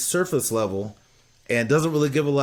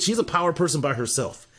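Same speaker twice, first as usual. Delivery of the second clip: normal talking voice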